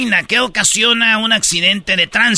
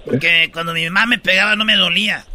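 ordinary talk

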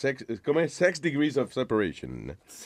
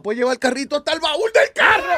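excited shout; 13 semitones higher, 4 dB louder than usual. Real, not acted. Excited about the shopping carts.

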